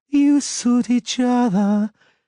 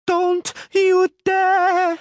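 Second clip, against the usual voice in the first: high, loud, slow piercing shriek; shrill scream; 7 dB louder, much slower than usual. A narrator's reading. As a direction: scream a warning at him. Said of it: "she screamed, piercingly shrilly".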